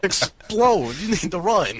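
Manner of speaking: high-pitched talking-bomb voice; Andy. Pretend. Talking bomb warns players to flee, high impersonation. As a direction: impersonate an urgent cartoon bomb. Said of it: "the voice of the guy from".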